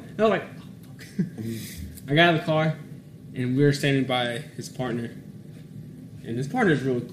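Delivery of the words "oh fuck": barely voiced "I'm done for"; whispered dread. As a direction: retell a sinking moment under your breath. Retold dread, told to get out.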